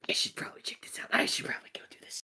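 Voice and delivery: fast, hushed whisper; quick unvoiced aside under the breath; whispering to self. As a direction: whisper hurriedly to yourself. Character talks to himself.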